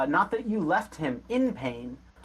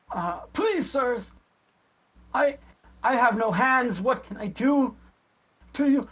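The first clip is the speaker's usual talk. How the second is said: put-on high, halting, pleading old man; old creaking beggar; 9 semitones higher than usual. Punched old beggar pleads with his attackers, creaking voice. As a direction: plead pitifully between pauses. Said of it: "this old creaking".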